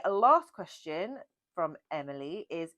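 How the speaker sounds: usual speaking voice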